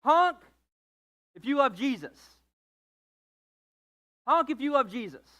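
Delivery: quoting a classic bumper sticker; mock bumper sticker. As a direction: read the sticker like a shout. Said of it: "classic one".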